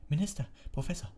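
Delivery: high-pitched matronly indignation; indignant. A narrator's reading. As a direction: call the men to order, affronted. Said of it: "sagte Madame Pomfrey empört". (empört, mit hoher Stimme)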